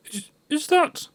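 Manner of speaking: high, halting disbelief; stunned surprise. Acted. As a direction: gasp it out, barely believing. Scrooge sees his past self.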